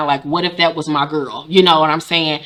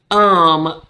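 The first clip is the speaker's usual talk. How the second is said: ordinary delivery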